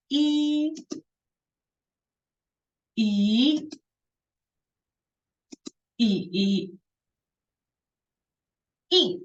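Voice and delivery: e drilled through the four tones, dipping on the third; walk e through the tones. Shown as a demonstration. Teacher drilling the four tones on e again. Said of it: "And then will be".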